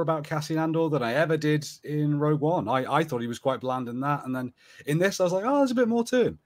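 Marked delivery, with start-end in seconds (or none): none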